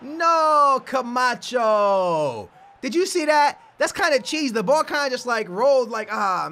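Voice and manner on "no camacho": long wailed "no"s sliding downward; stretched-out cry of dismay; drawn-out lament. Real, not acted. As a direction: wail it out slowly, letting each name fall. Mock wail at a game miss.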